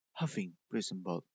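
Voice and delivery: usual speaking voice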